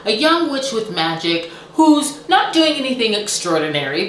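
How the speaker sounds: ordinary talk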